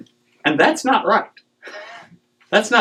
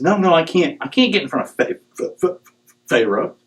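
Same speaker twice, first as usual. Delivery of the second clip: stuttering Moses; 6 semitones higher than usual. Retold or imitated. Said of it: "relents and says".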